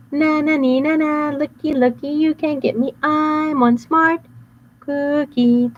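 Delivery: high sing-song teasing chant; slow mocking nyah-nyah; taunting cookie. Cookie taunting the bad boys, high sing-song.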